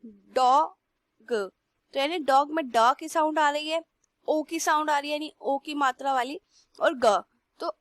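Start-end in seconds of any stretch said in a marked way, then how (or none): 0.1-1.3 डॉग stretched very slowly so each sound is heard; drawn-out. Shown as a demonstration. Teacher drawls डॉग slowly for students. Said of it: "अलग अलग करके देखो".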